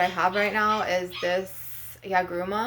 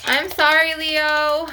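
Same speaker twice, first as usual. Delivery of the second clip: calling out; 8 semitones higher, 6 dB louder, much slower than usual. Real, not acted. Calling out to pet.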